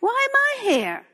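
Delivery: high, bewildered plea to God; the prophet crying out, lightly voiced; Habakkuk's complaint. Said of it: "Habakkuk did the same thing".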